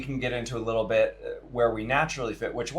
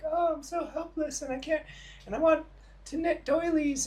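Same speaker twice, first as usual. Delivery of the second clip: put-on much higher, breathy falsetto parody of a helpless girlie girl; mock helpless girl; 4 dB quieter than usual. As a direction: squeak it out like a damsel who can't do a thing. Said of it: "a stereotypical traditional girl who's like".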